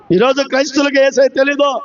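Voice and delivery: usual speaking voice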